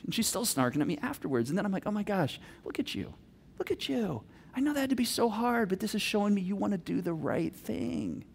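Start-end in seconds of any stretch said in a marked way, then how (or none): 1.9-4.2 soft, hushed admiration; tender praise. Acted. Dad to snarking daughter after she obeyed, tender praise. 4.6-8.3 soft, understanding praise; gentle encouragement. Acted. Dad to daughter who did right thing, gentle encouragement.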